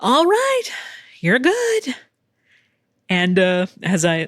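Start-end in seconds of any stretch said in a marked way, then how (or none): 0.0-1.9 high, bright-toned all-done call; chirpy technician. Retold or imitated. The mammogram technician telling her she's done, in a high chirpy voice. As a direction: sing out that it's over, cheerfully. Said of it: "and then it's".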